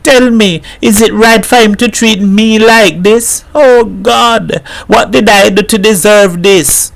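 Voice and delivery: high, pleading lament; crying. A narrator's reading. Missus Herrera weeps to the mother, high and pleading. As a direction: plead through tears. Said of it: "broke down and cried like a girl".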